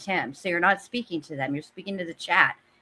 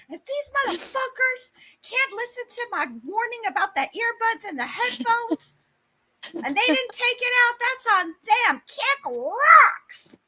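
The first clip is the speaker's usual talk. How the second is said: squeaky imitation building to a yell; high mock voice. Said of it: "she wants to say".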